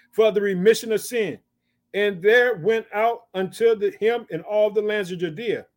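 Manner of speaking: measured, emphatic Bible reading; scripture declamation. Acted. Preacher reads of John baptizing in the wilderness, declaiming. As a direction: proclaim the verse phrase by phrase.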